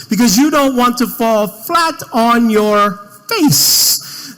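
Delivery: very loud, slow, drawn-out; big mock warning. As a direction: blare the warning, stretching it out.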